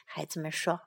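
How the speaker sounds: ordinary delivery, nothing marked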